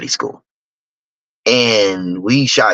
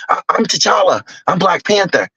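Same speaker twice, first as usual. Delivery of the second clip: higher, lightly voiced excited little boy; imitating little boys; 8 semitones higher than usual. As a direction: mimic a kid proudly playing superhero. Little boys leaving the movie.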